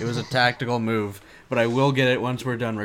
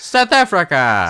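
raised, loud mock South African accent; 5 semitones higher, 5 dB louder than usual. Shown as a demonstration. Cohost demonstrating accent vowels, South African accent. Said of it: "South African, which is just Australian".

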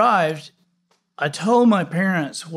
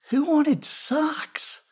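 slow, high, whispered disbelief; indignant whisper; 12 semitones higher, much slower than usual. Acted. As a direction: hiss it out in slow outrage. Child's outrage over socks.

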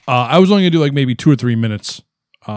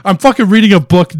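loud, high mock-indignant complaint in character; put-on ragtime voice; 8 semitones higher, 7 dB louder than usual. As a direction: protest without dropping the ragtime act. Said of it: "doing a voice".

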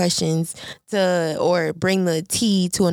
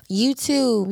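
normal talking voice

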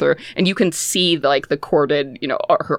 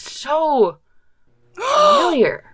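dramatic gasp; 11 semitones higher, much slower than usual. A narrator's reading. GM gasps at revelation.

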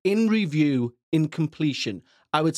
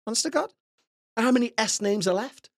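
much higher put-on voice, fed up; mock exasperated listener; 9 semitones higher, faster than usual. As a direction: whine it like an exasperated fan.

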